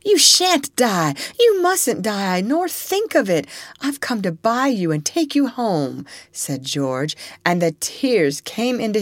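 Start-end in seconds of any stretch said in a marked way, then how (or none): 0.1-6.1 tearful pleading. A narrator's reading. George pleads, tears in eyes.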